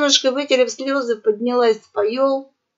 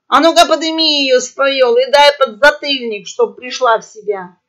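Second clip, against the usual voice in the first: loud, raised-voice command; barked order; 3 semitones higher, 7 dB louder than usual. A narrator's reading. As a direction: order them about angrily. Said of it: "заорал Хрякер". (громкий сердитый приказ)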